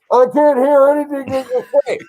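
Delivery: mock broken microphone voice; bad mic sound. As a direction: pretend the mic is garbling you. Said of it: "and it sounded like this".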